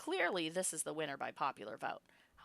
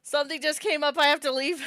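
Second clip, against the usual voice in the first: higher, louder hurried excuse; mock flustered exit; 9 semitones higher, 13 dB louder than usual. Real, not acted. Streamer jokingly leaving after the Toad teasing, in a higher, louder voice. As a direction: pretend to run away embarrassed.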